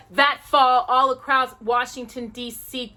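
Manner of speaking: ordinary delivery, nothing marked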